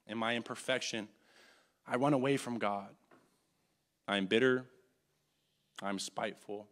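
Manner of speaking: soft, low, intimate admission; quiet confession. Real, not acted. Preacher confessing his own failings to the church, soft and low.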